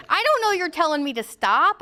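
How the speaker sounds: normal talking voice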